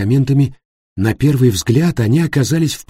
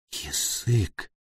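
very soft, faint murmur; barely audible; 3 semitones lower, 12 dB quieter than usual. A narrator's reading. Jenni horrified at the open mouth, barely audible. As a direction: let the word hardly come out. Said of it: "произнесла она едва слышно".

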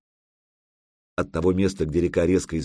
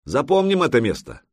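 raised, emphatic call; exclaiming; 6 semitones higher, 4 dB louder than usual. A narrator's reading. Ярл telling crew to remember the place, exclaiming. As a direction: proclaim it high to everyone aboard. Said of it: "воскликнул Ярл".

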